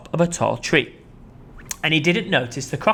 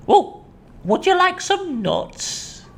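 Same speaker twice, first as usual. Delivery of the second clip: much higher, slow offer; friendly monkey; much slower than usual. Acted. Mugglewump offering nuts to the crocodile.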